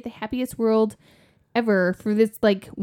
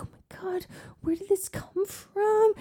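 high, slow, whispered dread; horrified whisper; 10 semitones higher, 4 dB quieter than usual. Retold or imitated. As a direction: breathe it out in horror. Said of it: "And I was like".

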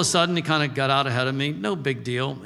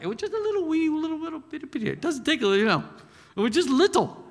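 cutesy tiny voice; 13 semitones higher, faster than usual. Acted. Preacher describing the tiny nettle plant in a squeaky cutesy voice. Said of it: "tiny plant".

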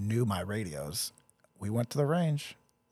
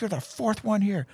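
much higher, incredulous sergeant rant; outraged first sergeant; 10 semitones higher, 4 dB louder than usual. Retold or imitated. First sergeant spotting another ginger soldier, much higher outraged disbelief. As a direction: play the fed-up sergeant, pitched way up.

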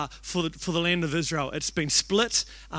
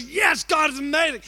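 excited shout; 8 semitones higher than usual. Retold or imitated. Friend shouting praise while hitting him, high excited shout. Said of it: "Garth goes".

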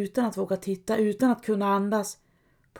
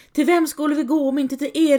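higher, louder cry to the sky; calling out; 6 semitones higher, 5 dB louder, faster than usual. Acted. Helga runs and calls out to God, loud. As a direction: call out to God in despair. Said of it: "ropar åt Gud".

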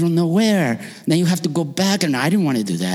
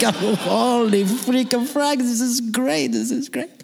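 gushing delight; 8 semitones higher than usual. Retold or imitated. Excited tourist gushing over dinner.

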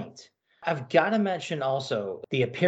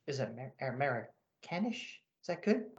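quiet aside; 12 dB quieter, faster than usual. Real, not acted. Host asks about pronunciation aside.

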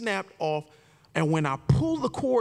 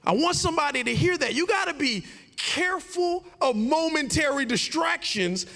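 preaching; 8 semitones higher than usual. Real, not acted. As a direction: drive the point home to the congregation. Preaching the lesson at full force.

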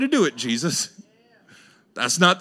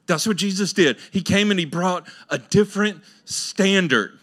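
ordinary talk

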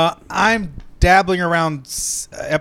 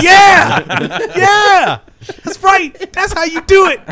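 much higher, louder excited yelling with a laugh; cheering shout; 8 dB louder, slower than usual. Retold or imitated. Reliving the show, cheering the vigilante's comeback. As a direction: whoop like a fan at a fight. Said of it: "I'm like".

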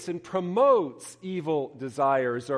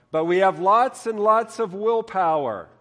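loud, proud declaration; 6 semitones higher, 9 dB louder than usual. Acted. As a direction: boast it proudly. Frog proudly declares willpower.

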